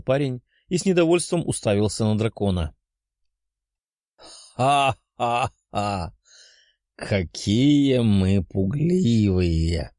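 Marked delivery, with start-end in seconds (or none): 4.2-6.1 booming dragon laugh. Acted. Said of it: "рассмеялась драконья морда". 7.0-9.9 slow monstrous mocking voice; mocking dragon. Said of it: "рассмеялась драконья морда".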